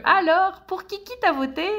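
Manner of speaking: high-pitched put-on nosy voice; prying voter question. Someone raising politics with a friend, high nosy voice. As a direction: jump up high to ask nosily.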